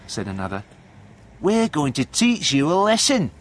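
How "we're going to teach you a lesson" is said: high, put-on bullying jeer; raised, high-pitched threat; gang threat. A narrator's reading. Third boy threatens caught John, high jeering menace.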